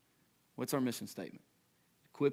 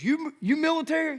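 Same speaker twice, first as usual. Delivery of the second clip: high, loud imitation of a blunt questioner; Big Steve's question; 8 dB louder than usual. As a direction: blurt it out high and loud, mimicking him. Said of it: "He walks up and he goes".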